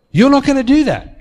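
retold woman firmly insisting. Hostage repeats her refusal.

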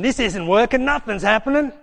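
high, loud panicked cry; loud, high, desperate wail of a dying unbeliever; panicked screaming. Acted. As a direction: scream it out in frantic despair. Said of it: "You wanna die screaming".

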